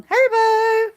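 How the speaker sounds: high, loud, drawn-out call. Real, not acted. Vlogger summons Haribo for a biscuit, calling out. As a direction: sing out the name to summon an animal. Calling another animal over.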